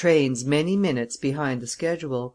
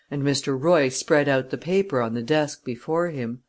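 ordinary delivery

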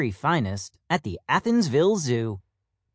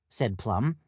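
ordinary delivery, nothing marked

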